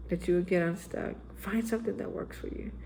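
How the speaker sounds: ordinary talk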